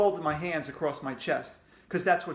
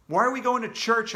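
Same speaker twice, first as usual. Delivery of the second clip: anxious question; 7 semitones higher, 5 dB louder than usual. Acted.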